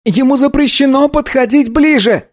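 put-on very high boy's outcry; boy's shout. A narrator's reading. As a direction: forbid it in a high-pitched cry. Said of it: "крикнул мальчик".

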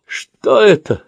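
high shout through a gag; muffled shout. Acted. Gagged old man crying out in alarm. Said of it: "приглушённо кричит он из-под кляпа".

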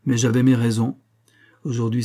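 ordinary delivery, nothing marked

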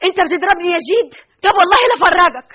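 high, loud threatening cry; indignant threat. Acted. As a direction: swear revenge. Threatens after being hit. (تهديد غاضب)

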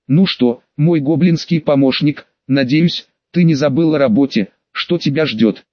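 ordinary delivery

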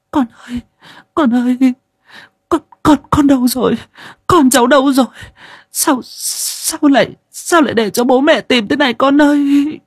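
very high, loud sobbing wail, slowing on sao lại; a mother breaking down crying for her child; tearful wailing mother. Acted. Mother cries out searching for her lost son, sobbing wail. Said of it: "mà mếu máo".

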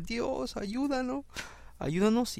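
high, pleading little prayer, a touch of whine; mock hasty prayer. Preacher mimics a shallow prayer, high and pleading. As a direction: cry out a quick, half-hearted plea to God.

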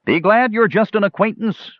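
high, teasing call back; laughing shout. A narrator's reading. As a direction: shout it back across a distance. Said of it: "Tanis laughed and shouted".

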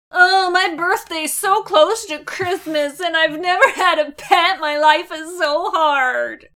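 put-on whiny spoiled girl, slow ending; spoiled rich girl. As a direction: whine like a pampered rich girl. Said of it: "Poor little rich girl".

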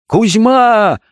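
high, long-drawn frenzied call; frantic shout. A narrator's reading. Bound doctor suddenly calls his servant, frantic shout. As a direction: yell the name out wildly. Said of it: "вдруг закричал он неистово".

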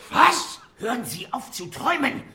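high, loud impatient yell; angry shouting. Acted. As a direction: snap him out of his daze. Doctor snaps at helper.

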